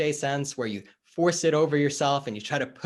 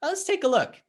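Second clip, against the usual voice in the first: higher, louder breezy push; just go ahead and open it; acted coach insisting; 12 semitones higher, 6 dB louder than usual. Coach overriding the client's refusal, higher and louder.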